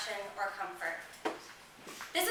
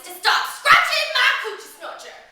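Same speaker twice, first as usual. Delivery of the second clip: loud, raised scolding as a child imitates it; scary threatening voice; 13 dB louder than usual. Girl imitating her mama's warning, loud and threatening. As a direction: boom out the threat, pitched up. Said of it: "in a scary, loud, life-threatening voice".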